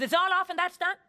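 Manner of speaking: high, loud public outburst; shouted break-up, as retold; retold shouting. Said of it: "I'm shouting at him".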